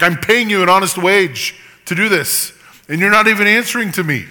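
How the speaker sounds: a master scolding servants who ignore him; frustrated master. Retold or imitated. Job complains to silent servants, frustrated and loud. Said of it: "Calling out to your servants".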